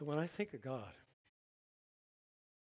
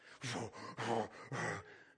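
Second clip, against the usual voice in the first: imitated heavy bear huffs; bear breathing. Telling how the bear came back again, heavy imitated huffing. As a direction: puff out slow, heavy animal breaths.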